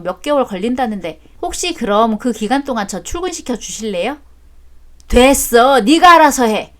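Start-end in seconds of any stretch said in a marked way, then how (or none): 5.1-6.7 curt and angry. Acted. Father sulks, refuses.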